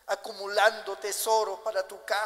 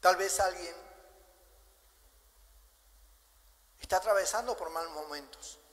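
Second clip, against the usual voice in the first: altar call; 2 semitones lower, 3 dB quieter than usual. Acted. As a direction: invite them gently to bow and reflect.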